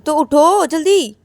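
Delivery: loud, high, drawn-out command; impatient order. Acted. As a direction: hurry a sleepy partner out of bed. Acted impatient order to get up. (झुंझलाकर ज़ोर से उठाते हुए)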